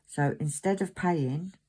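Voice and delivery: ordinary talk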